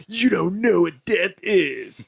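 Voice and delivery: slow, grave mock warning; Loomis, dead serious. Doctor Loomis quoted again for laughs, slow and hammed-up grave. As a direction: drag it out like a ham actor. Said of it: "that line just kills me every fucking time".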